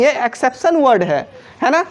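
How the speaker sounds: usual speaking voice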